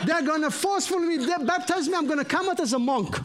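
high-pitched, comically indignant protest; mock alarm. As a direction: exaggerate the dread for laughs. Joking protest to sister in story.

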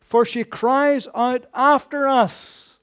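loud, slow, pressing plea; loud and slow, pushing the master to act; urgent demand. Retold or imitated. Disciples urging Jesus, loud slow insistent demand. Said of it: "urged him, saying".

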